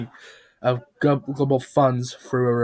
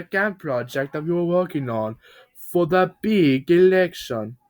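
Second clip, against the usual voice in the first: cheery, raised builder voice addressing the gang; a jolly builder host pitched up; Bob the Builder voice; 5 semitones higher, 3 dB louder than usual. Acted. Bob briefs his crew on the project, cheery and loud.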